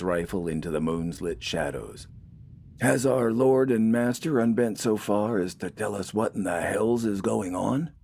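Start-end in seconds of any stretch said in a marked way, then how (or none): 2.8-7.9 lightly voiced older farmer, dry and mocking; sarcastic jab at the boss, a touch higher; older man grumbling about his superior; wry old farmer. A narrator's reading. Nathan mocks the corporal.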